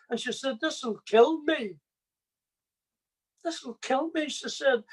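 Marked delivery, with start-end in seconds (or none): 0.6-4.3 higher, breaking mother's voice, a touch of tears; mother's anguished plea. Retold or imitated. Mother pleading with her jailed son, lightly imitated anguish. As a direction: let the mother's grief rise in a mild imitation. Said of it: "she cried sore".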